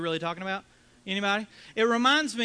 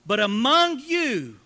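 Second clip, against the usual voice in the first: emphatic preaching; 4 semitones higher, 6 dB louder, slower than usual. Acted. Preacher stressing the contrast.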